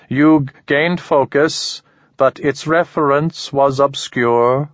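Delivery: slow, high, otherworldly alien; measured alien, unhurried and odd. Acted. Alien speaks to Ramon.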